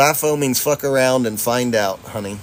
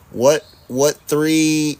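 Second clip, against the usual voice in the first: ordinary delivery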